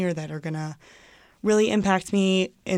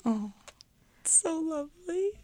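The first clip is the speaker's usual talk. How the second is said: much higher, softer, voice welling up; touched, near tears; 10 semitones higher, 6 dB quieter than usual. Real, not acted. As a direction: say it moved almost to tears. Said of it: "Don't cry".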